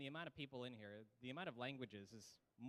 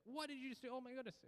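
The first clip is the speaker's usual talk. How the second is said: mock angry teacher; 10 semitones higher than usual. Imitating an angry teacher for the class, high and mock-outraged. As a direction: playfully imitate a teacher pretending to be furious. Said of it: "get very angry and be like".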